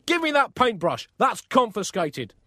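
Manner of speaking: high, loud scolding order; angry command. Acted. Furious Pope confiscates the painter's brush, angry command. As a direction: bellow it like a hammed-up headmaster.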